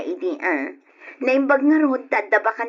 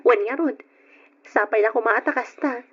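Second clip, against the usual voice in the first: ordinary talk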